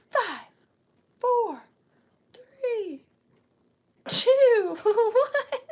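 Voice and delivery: rising hype countdown; building excited countdown. Pretend. Countdown climbing to the end.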